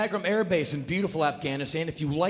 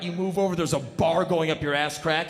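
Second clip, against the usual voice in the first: ordinary talk, nothing marked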